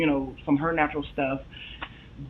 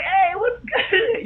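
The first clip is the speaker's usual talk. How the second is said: friendly hyped greeting; 5 dB louder, much slower than usual. Retold or imitated. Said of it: "be like, geek too, like".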